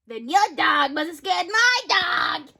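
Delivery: normal talking voice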